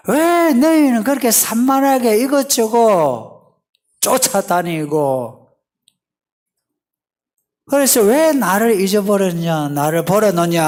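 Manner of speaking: loud, high scolding in God's voice; frustrated reproach. Acted. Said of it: "하나님의 그 답답함".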